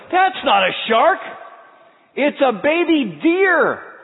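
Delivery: high, slow, drawn-out wonder; amazed realization. Retold or imitated. Telling the congregation about the deer, high slow amazement. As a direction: let the surprise stretch out slowly.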